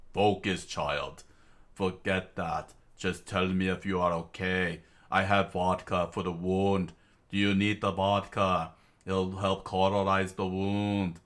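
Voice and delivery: put-on light Russian accent, concerned. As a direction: check on a comrade in Russian accent. Tatiana, Russian voice.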